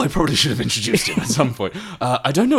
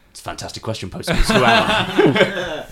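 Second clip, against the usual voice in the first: theatrical riddler; 7 semitones higher than usual. Acted. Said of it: "I stop riddling immediately, like".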